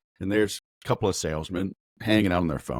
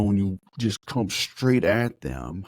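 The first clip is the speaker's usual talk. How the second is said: normal talking voice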